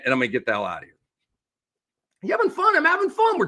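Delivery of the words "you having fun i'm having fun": playful teasing. Real, not acted. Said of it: "teasing cohost mid-rant".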